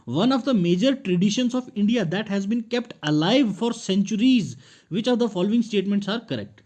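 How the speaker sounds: slow, loud, deliberate reading of the exam question. Acted. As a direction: read each word out slowly like a test paper. Reads exam question aloud slowly.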